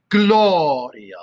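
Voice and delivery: GLO of Gloria leaned on loud and long; first-syllable stress. Shown as a demonstration. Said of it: "emphasis on the first syllable".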